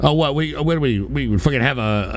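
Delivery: ordinary talk, nothing marked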